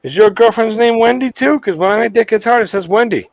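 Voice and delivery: put-on high Jamaican accent; Jamaican guy. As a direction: ask eagerly in a Jamaican voice. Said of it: "this Jamaican guy".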